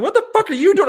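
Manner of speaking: high, loud mock shriek of disbelief; retold outraged yell. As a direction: act out a shocked outburst. Retold outburst at the saleswoman.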